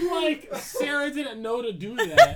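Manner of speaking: high, loud, through real laughter; laughing. Real, not acted. Podcaster explains the plot after yelling run, laughing. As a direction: talk while cracking up. Cracking up after own mock yell.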